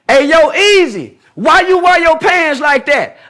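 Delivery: hammed-up heckler voice; mock heckler. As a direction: play the guy calling him out. Voicing a heckler questioning him.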